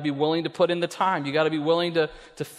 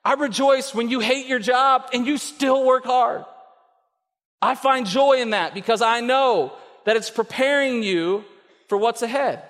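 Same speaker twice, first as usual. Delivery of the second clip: God's bright raised voice; God rejoicing; 8 semitones higher than usual. Retold or imitated. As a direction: let him delight in your effort. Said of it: "And he says".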